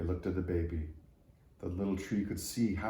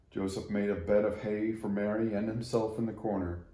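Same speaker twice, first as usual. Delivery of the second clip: plain reading voice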